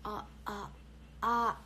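ordinary talk, nothing marked

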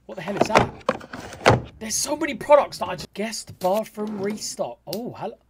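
normal talking voice

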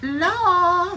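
panicked high yell; screaming in fright; scared shout. Real, not acted. Jump scare.

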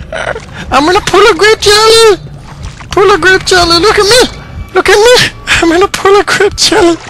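very high, loud squealing voice; silly high squeal. Acted. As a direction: screech it like a manic cartoon. Shrieking silly voice.

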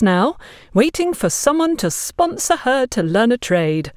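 pushy busybody. Acted. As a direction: brush her objection aside. Agatha dismisses Gloria's worry.

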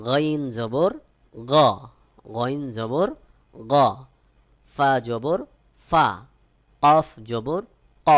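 loud, measured sounding-out of letters; letter drill recitation. Shown as a demonstration. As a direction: call out each letter like a teacher drilling a class. Teacher drills Arabic letter sounds.

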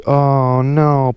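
ordinary talk

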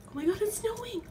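high, thrilled exclamation; excited. Real, not acted. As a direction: burst out in amazement at the sight. First sight of snowfall.

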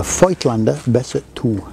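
amateur German accent on the name. Shown as a demonstration. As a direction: say it with your best German accent. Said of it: "That's my best German accent".

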